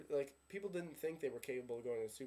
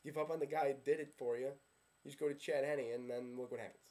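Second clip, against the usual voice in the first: usual speaking voice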